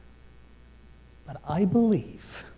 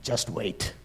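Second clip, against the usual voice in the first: low quiet retort; 8 semitones lower, 8 dB quieter than usual. Retold or imitated. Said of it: "I said".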